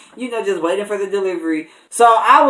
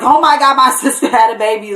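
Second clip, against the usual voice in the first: much higher and louder, thrilled outburst; excited scream; 7 semitones higher, 5 dB louder than usual. Retold or imitated. Uncle seeing his newborn niece's photo, joyful loud scream. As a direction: burst out with joy at the news. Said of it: "I screamed so loud".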